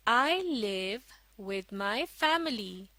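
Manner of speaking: very slow, word by word; slow model reading. Shown as a demonstration. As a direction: read it out slowly for learners to follow. Second model reading for learners. (धीरे-धीरे, एक-एक शब्द पढ़कर)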